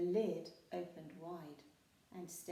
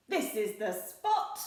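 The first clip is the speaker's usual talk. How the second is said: loud pirate voice; a captain who has found the spot; 11 dB louder than usual. A narrator's reading. Handkerchief announcing the treasure spot, loud. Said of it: "said Handkerchief".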